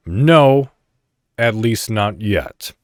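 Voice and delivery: usual speaking voice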